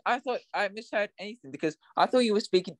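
ordinary talk, nothing marked

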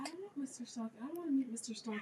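hushed, nervous whisper; scared whisper. Real, not acted. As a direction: whisper it like someone might hear. Scared whisper about stalker.

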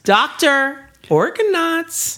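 high, slow mock alarm; mock alarmed doctor. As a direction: cry it out to the doctor, stretched.

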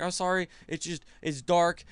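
high, halting imitation of nervous apology; mock intimidated stammer. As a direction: stammer out a cowed excuse. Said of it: "Why are you stuttering?".

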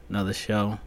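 usual speaking voice

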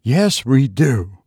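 slow, firm contradiction; peremptory. A narrator's reading. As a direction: overrule her firmly, word by word. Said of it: "returned Missus Brigham peremptorily".

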